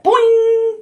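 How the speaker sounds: high, bouncy boing for a startled rabbit; spring up with a silly boing; playful sound effect boing; cartoon boing. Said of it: "He's like".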